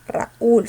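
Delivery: RAÚL split in two, force on the U; stressed closed vowel. Shown as a demonstration. Teacher asking which word takes the accent, stressed U in Raúl. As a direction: break "Raúl" into ra-ÚL, hitting the U. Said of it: "cerrada con mayor fuerza de voz".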